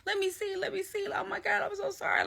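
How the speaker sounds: high, small panicky pleading; retold panic. Apologizing to bleeding Kay, retold panicked pleading. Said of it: "Like, I'm panicking".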